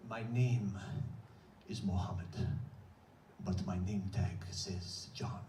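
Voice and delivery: put-on low, slow Egyptian accent telling his story. Bodega clerk Mohammed telling his story, low Egyptian accent. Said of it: "he says in a heavy accent".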